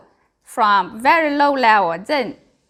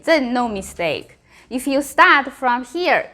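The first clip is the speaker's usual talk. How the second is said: usual speaking voice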